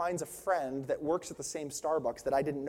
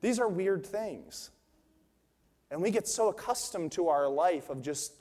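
ordinary talk

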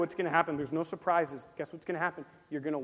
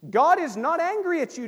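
usual speaking voice

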